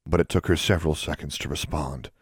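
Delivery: ordinary delivery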